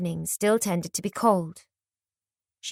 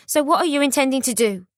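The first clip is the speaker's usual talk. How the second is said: higher, quick, anxious question; 5 semitones higher, faster than usual. A narrator's reading. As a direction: ask it nervously.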